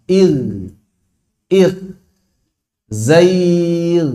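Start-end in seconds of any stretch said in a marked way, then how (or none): 0.1-2.0 il with a thin ghain, matched to ghain with kasrah; il kept light and thin; correct il. Shown as a demonstration. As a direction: model il at the level of ghain with kasrah. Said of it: "Samakan derajatnya dengan ghain kasrah".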